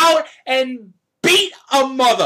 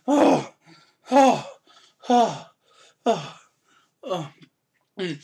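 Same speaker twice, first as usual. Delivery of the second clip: catching breath; 6 semitones lower, 4 dB quieter, slower than usual. Real, not acted. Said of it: "I think I'm calm now".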